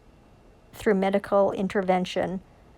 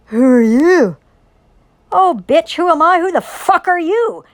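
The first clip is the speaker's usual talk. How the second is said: loud, far higher, sneering challenge; mock confrontation; 8 semitones higher, 10 dB louder than usual. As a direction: fire it back at them like a street fight. Mock confrontation with people in outfits.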